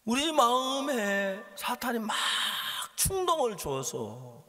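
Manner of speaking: loud, slow, drawn-out pulpit delivery; high, impassioned sermon voice; forceful preaching. Acted. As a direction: stretch key words and press the warning home.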